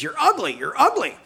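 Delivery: high squawked taunt; taunting parrot. Acted. Parrot taunting the man walking away, high squawk. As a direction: jeer repeatedly in a parrot voice. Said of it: "the parrot says".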